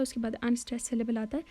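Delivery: ordinary talk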